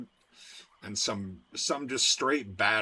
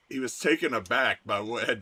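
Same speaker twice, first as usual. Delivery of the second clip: usual speaking voice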